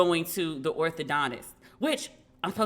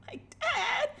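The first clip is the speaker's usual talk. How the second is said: hurt whine; 3 dB quieter than usual. Retold or imitated. Retold whine at father.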